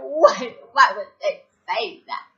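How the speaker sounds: loud mock disbelief, high at first; overdramatic, tearful-style protest; loud and extra. Reacting to friends' bomb talk, loud and extra. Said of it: "saying it like I'm being so loud and so extra".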